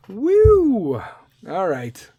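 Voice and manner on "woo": high loud celebratory woo; whoop. Real, not acted. Host cheers after finishing his joke, loud whoop. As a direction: let out a joyful whoop.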